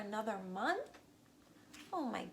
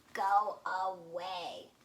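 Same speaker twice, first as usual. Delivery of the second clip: slow, louder grumble from bed; grumpy sleepy voice; 5 dB louder, much slower than usual. Acted. Toad grumbling at Frog from under covers, slow loud. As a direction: drag it out, leave me alone. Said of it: "cried the voice".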